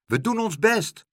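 high-pitched shouted reply; calling back. A narrator's reading. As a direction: call back down to her. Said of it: "riep Pluk terug". (hoog terugroepen)

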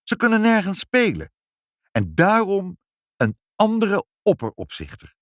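furious mother demanding change; indignant. A narrator's reading. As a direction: let the outrage rise. Mother keeps up indignant protest.

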